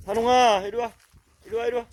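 high, loud coaxing call to a dog. Real, not acted. Owner calling his hiding dog to come out, loud and high. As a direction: coax the hiding dog out from afar.

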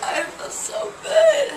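high, loopy giggling delight; drugged giggle, floating happily; giddy and high. Real, not acted. Giddy patient after anesthesia.

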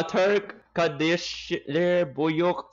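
"Turk, Kadesh, Buyuk" sounded out haltingly by a non-speaker; "Turk, Kadesh, Buyuk" in mangled learner Turkish; clumsy Turkish. Real, not acted. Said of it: "through your pronunciation".

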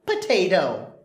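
ordinary talk

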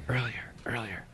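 hushed unvoiced defense; whisper. Real, not acted. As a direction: whisper it, almost no voice. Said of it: "I really like the whisper in his voice".

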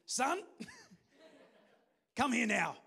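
scolding parent. Retold or imitated. Parent calling the lost son back, laughing mock scold. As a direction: play the cross parent calling the boy back, half laughing. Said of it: "Or is that the father?".

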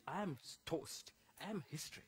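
normal talking voice

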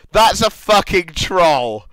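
loud, slow, emphatic outburst; exasperated insistence. Real, not acted. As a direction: hammer it out loudly, word by word. Insisting a post is a troll.

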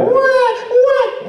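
loud, very high imitation baby wail; crying baby. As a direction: bawl like a baby wanting to be held. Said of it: "and that baby over there".

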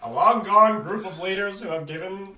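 high, loud cartoon villain; Plankton. Host resuming the synopsis after losing it, cartoon villain impression. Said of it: "I'll read it in Plankton's voice".

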